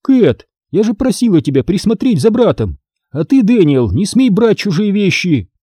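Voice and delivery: high-pitched reprimand; exasperated parent; motherly scolding. A narrator's reading. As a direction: tell off each child in turn. Mother scolding both children.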